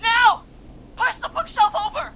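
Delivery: shouting. Acted. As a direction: yell to the team in a panic. Shouting orders during trap.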